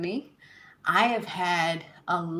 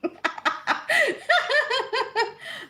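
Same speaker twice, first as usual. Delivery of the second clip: a sudden high peal of laughter; high burst of laughter. Real, not acted. Host bursting out laughing at a viewer's message.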